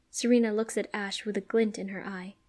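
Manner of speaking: usual speaking voice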